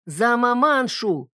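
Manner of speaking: put-on high, slow, odd drunken shout; botched toast. A narrator's reading. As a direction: blurt the toast clumsily.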